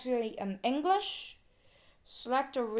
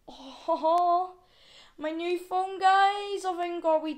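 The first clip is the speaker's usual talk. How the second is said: big excited rise, much louder and higher; thrilled; 7 semitones higher, 7 dB louder, slower than usual. Real, not acted. Vlogger gushing to viewers over new phone, thrilled.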